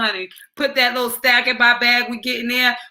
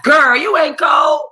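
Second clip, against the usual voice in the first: scolding daughter; 7 semitones higher, 6 dB louder, slower than usual. Retold or imitated. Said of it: "I'm like".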